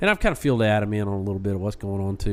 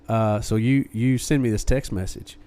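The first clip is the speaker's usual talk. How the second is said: ordinary talk, nothing marked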